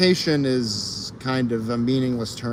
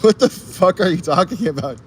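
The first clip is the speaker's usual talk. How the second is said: loud, high, stunned outburst; shocked disbelief; 6 semitones higher, 5 dB louder than usual. Real, not acted. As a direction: yell it in total disbelief. Shocked reaction to chat.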